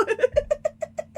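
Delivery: loud, high squealing laugh; high helpless laughter. Real, not acted. Speaker cracking up at the hokey pokey slip, high laughter. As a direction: crack up loudly.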